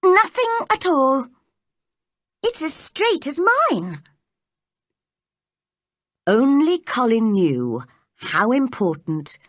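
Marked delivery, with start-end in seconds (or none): 0.0-4.0 high, emphatic insistence; firm little girl, pitched up; cross. A narrator's reading. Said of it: "crossly spoken".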